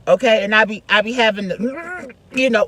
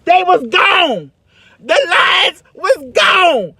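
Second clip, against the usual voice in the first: loud, much higher, slow lament; dramatic wail; 10 semitones higher, 6 dB louder, much slower than usual. Retold or imitated. Telling the audience her memorized lines vanished, a loud slow wail. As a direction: mourn the lost lines like a tragedy.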